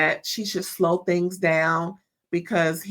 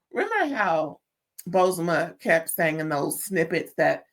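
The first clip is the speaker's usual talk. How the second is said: ordinary talk, nothing marked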